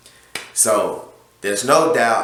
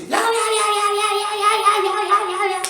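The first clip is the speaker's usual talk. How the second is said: very high, squealing yeah yeah yeah like a cartoon chipmunk; mimicking an angry customer's high-pitched rant; mock squeaky gibberish. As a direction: imitate a frantic caller's unintelligible squeal. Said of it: "That's all I'm hearing".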